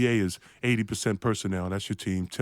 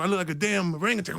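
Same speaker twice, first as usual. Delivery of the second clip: ordinary delivery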